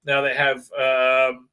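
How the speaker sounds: ordinary delivery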